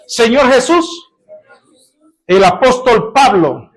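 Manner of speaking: fervent prayer. Acted. Pastor praying aloud before the congregation, rising in fervor.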